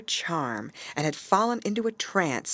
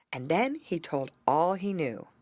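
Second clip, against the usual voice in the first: ordinary delivery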